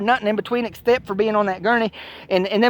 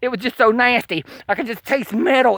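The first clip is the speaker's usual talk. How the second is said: usual speaking voice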